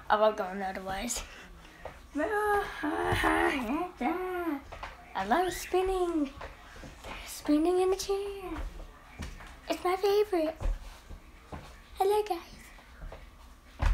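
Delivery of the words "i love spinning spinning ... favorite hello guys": high soft giddy voice; dizzy squeaky voice. Real, not acted. Dizzy, giggly, squeaky while spinning.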